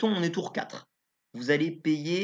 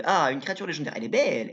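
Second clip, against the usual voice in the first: ordinary talk, nothing marked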